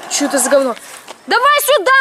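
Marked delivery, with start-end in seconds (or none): 1.3-1.9 calling out. Real, not acted. Calling friend over.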